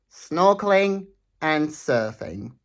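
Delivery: ordinary delivery, nothing marked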